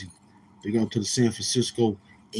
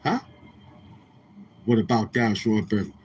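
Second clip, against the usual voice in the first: ordinary talk, nothing marked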